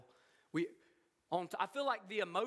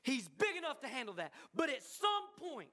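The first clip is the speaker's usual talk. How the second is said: usual speaking voice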